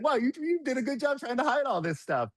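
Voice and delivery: mock impressed guy. Quoting the drug buddy finding hidden stash, high mock-impressed voice. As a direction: squeak it out like a friend caught off guard, amused.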